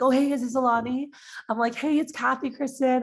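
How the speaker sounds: high, chirpy hello to a stranger on the phone; bright phone greeting. Retold or imitated. Seller calling a referred stranger, upbeat high greeting. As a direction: pitch it up like a friendly cold call.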